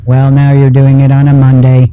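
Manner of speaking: flat, even robotic drone; deadpan, no pitch movement at all; monotone voice. Pretend. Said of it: "How do you like my new voice?".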